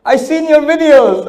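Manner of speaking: high, loud insistent accusing voice; woman accusing him. Retold or imitated. Lady at the airport insisting he's Mufti Menk. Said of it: "She goes".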